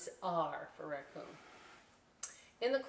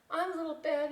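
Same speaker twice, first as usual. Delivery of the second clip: put-on little kid voice; 9 semitones higher, 5 dB louder than usual. Little Bat character voice.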